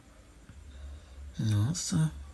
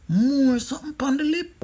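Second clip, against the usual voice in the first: high mock voice; 14 semitones higher, 6 dB louder than usual. Repeats the line in a mock voice.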